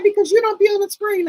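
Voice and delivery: put-on a kid explaining matter-of-factly; lightly voiced niece. Niece quoted, voiced higher.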